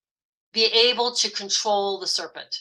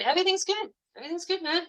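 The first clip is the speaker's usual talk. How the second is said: mock carefree; 10 semitones higher, 5 dB quieter, much faster than usual. Voicing a carefree attitude.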